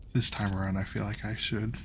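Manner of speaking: ordinary delivery, nothing marked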